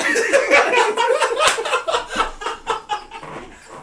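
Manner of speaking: long high laugh; big laughing fit. Real, not acted. Laughing hard at his own bit. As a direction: break up laughing. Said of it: "after yell".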